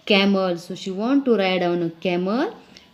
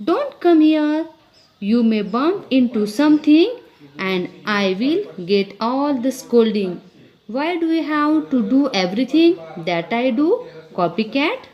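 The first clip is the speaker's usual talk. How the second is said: put-on annoyed girl; 4 semitones higher, faster than usual. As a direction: snap at the tagalong baby. Reading the girl's dialogue aloud.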